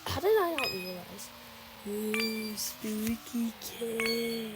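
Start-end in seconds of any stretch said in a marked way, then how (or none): 1.9-4.5 spooky voice. Pretend. Spooky voice to viewers.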